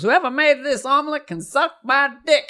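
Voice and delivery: high-pitched comic holler, drawn from a star customer; high, crude verdict in a put-on voice; Richard Pryor. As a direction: imitate the comedian's high-voiced outburst. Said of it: "I gave Richard Pryor a Denver omelet".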